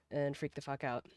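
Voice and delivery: ordinary talk, nothing marked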